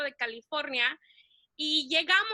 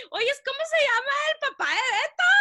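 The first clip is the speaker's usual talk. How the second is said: excited phone question; 10 semitones higher, 5 dB louder, faster than usual. Retold or imitated. Cousin on phone, retold.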